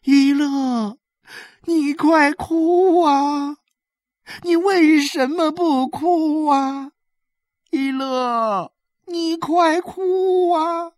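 high, stretched-out pleading shout; crying out. A narrator's reading. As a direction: urge desperately, dragging out the last syllables. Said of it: "何小勇的女人又叫道".

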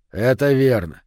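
ordinary delivery, nothing marked

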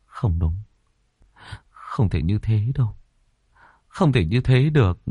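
tearful denial. Acted. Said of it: "nước mắt lăn ra".

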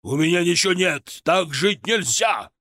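roaring. A narrator's reading. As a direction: bellow it for effect. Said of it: "взревел Костелано".

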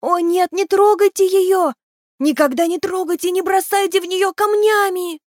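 high-pitched tiny creature pleading; thin little voice. A narrator's reading. Tiny creature begging children not to throw stones, thin piping plea. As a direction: plead in a small, high, singsong pipe. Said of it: "пропел тоненький голосок".

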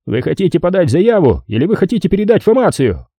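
high-pitched desk cop spelling out the options; high, officious phone voice; put-upon police voice. A narrator's reading. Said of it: "Голос вполголоса вздохнул".

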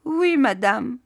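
a hint of a whimpered, high reply; lightly voiced tearful maid; moaning. Maid admits it to her mistress, mild moan. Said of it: "gémit".

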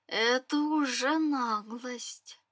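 ordinary delivery

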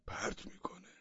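hushed warning; old man whispering to the crowd. A narrator's reading. Old man warns quietly.

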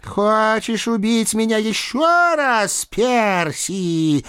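high, slow talking-cat voice; silky, high-pitched feline taunt; purring cat. A narrator's reading. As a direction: draw the words out like a cat. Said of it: "мягко промурлыкала кошка".

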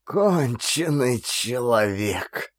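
slow, quiet and bitter. A narrator's reading. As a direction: say it with bitter regret. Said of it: "С горечью повторил он".

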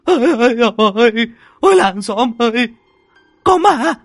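shrill, very high, loud screams of terror; terrified screaming. Acted. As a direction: scream for help as if a ghost grabs you. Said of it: "Chúng nó gào thét lên".

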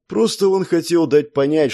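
plain reading voice

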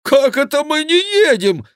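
very high, loud indignation; indignant monk. A narrator's reading. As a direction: protest high and loud, outraged. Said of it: "возмутился монах". (громкое высокое возмущение монаха)

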